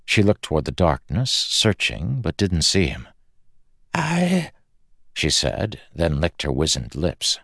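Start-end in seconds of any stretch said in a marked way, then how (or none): 3.9-4.5 high, faltering start; frightened woman. Acted. Exhausted woman answers the unseen voice, frightened.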